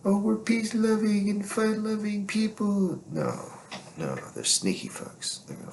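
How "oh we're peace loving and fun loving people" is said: much higher, slow, sing-song hippie parody; mocking hippie voice. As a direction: sneer at them in a dreamy hippie voice. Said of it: "they wear these colorful tie-dyed shirts".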